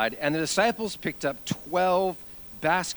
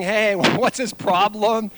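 slow, higher mock grumble; grumbling kids; 4 semitones higher, 4 dB louder, slower than usual. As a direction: imitate kids griping about a classmate. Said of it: "Other kids are grumbling".

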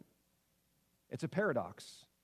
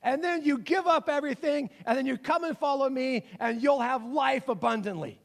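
lightly acted commencement speaker, pitched up; Jesus giving graduation speech; 5 semitones higher, 4 dB louder than usual. Jesus invited up to address graduating students, mock commencement speech. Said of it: "You invite Jesus up there. He says".

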